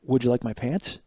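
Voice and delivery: hushed, deadpan offer leaned in close; quiet offer. Retold or imitated. Leaning over to the girl in shorts, offering his coat, hushed and deadpan. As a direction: murmur it politely right by her ear.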